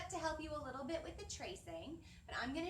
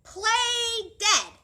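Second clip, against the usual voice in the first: lively, louder picture-book read-aloud; animated children's story voice; storytime reading; 8 dB louder than usual. Acted. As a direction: read it to the class with big expression.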